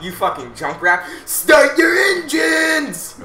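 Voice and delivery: shouting. Real, not acted. Yelling insults at game.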